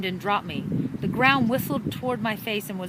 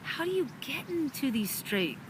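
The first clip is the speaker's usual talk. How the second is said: murmur; 4 semitones higher, 6 dB quieter than usual. A narrator's reading. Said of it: "Fleur murmured".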